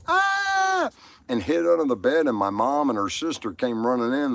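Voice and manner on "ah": very high yelled "Ah". Retold or imitated. A six-year-old yelling as he throws the sheet, very high yell. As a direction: let out a high child's yell. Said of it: "and yelled".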